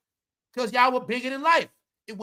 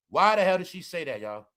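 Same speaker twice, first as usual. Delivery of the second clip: ordinary talk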